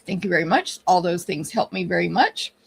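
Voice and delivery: usual speaking voice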